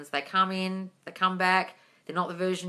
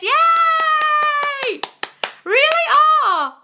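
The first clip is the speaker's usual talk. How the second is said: much higher and louder, squealing with delight; excited squeals; 9 dB louder, much slower than usual. Real, not acted. Host squealing at viewers joining her live stream, delighted high squeals.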